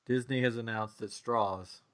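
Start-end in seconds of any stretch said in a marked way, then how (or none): 1.1-1.8 Tennessee accent. Real, not acted. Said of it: "that's my Tennessee accent coming out".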